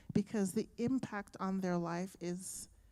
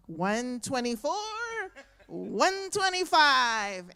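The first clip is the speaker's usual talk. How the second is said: slow, louder count to the finish; excited counting; 4 semitones higher, 6 dB louder, slower than usual. Retold or imitated. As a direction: count the last blocks out like a victory. Counting the last blocks.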